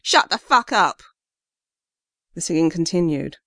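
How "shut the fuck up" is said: angry gruff shout, louder. Acted. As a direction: bark it at the singer, louder. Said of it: "gruffer male voice shouted".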